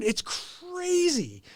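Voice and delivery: ordinary delivery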